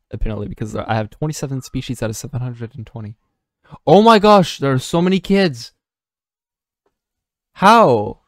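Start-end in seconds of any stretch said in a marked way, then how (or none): 3.9-8.2 high, loud astonished outburst; loud disbelief. Real, not acted. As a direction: blurt it out, stunned by the crowd. Reacting to kids yelling outside.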